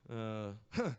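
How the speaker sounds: a low chuckle that slips out. Real, not acted. Comic laughing at a drunk heckler on the mic. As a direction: laugh it off before answering. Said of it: "it caused a chuckle".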